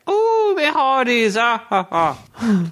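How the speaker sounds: very high, slow, botched Irish pirate; hammed-up bad Irish with a drawn-out laugh; attempted Irish accent. Shown as a demonstration. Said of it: "my Irish person".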